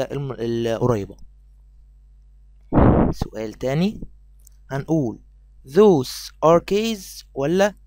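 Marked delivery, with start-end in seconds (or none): 5.7-7.7 "those are keys" and "these are keys" drawn out word by word; teacher slowly reads both answer choices; very slow quiz read. Shown as a demonstration. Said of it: "أنهو الأصح؟".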